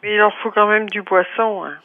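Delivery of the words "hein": usual speaking voice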